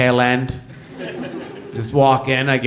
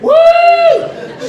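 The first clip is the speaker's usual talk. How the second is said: high, loud "woo" cheer; piercing pretend cheer; mock cheer; 7 dB louder than usual. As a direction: whoop like a lone fan in a crowd. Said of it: "not very many dyslexics cheer about it".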